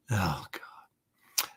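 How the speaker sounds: whispered oh God; whispered groan. Real, not acted. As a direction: breathe it out under the voice. Groans at the memory.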